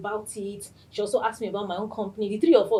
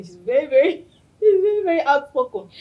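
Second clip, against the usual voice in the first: laughing while describing her, higher and louder; 7 semitones higher, 10 dB louder than usual. Real, not acted. Host laughing to listeners about her outspoken friend. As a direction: giggles through the words.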